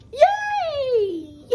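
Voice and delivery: high squealing yay, laughing; mock cheer. Imitating Brittany squealing with joy at the result. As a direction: shriek it with glee.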